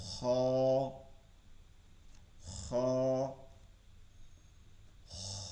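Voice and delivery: হ as a soft rasp at the back of the throat; soft kha sound. Shown as a demonstration. Teacher showing the soft throat খ to students. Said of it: "খ থেকে আরও নরম করতে হবে".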